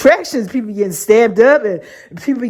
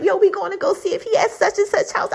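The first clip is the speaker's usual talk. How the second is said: friends' high voice; 8 semitones higher, 5 dB quieter, faster than usual. Retold or imitated. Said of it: "when they would be like".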